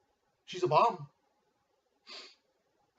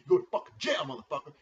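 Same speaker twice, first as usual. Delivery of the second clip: angry rant; 7 dB louder, faster than usual. Real, not acted. Commissioner ranting about a jailed player, angry. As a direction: blow up at him.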